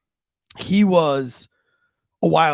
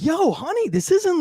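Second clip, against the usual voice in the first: much higher, incredulous yo honey; mock exasperation; 3 dB louder than usual. Husband bursts in on wife's spanking, high and incredulous. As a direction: pitch it way up in disbelief.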